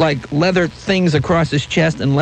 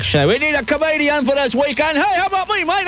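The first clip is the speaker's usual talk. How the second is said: high put-on cruise director, a lightly hammed character voice; 12 semitones higher than usual. Cruise director pitching comics at the Improv, in a high put-on voice. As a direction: play the smarmy cruise director recruiting comics.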